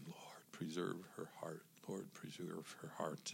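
hushed, unvoiced prayer under the breath; whispered prayer. Husband on a park bench whispering a prayer for her. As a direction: whisper the plea as if alone with God. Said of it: "I'm just praying".

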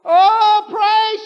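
loud high wail of oh, pray; imitating a crying plea. Preacher mimics the daughter wailing at confession meeting, loud high cry. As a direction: cry it out desperately. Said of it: "she said".

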